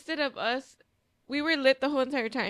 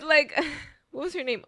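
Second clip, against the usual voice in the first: usual speaking voice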